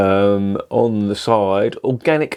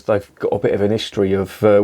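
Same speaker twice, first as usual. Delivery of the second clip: ordinary talk, nothing marked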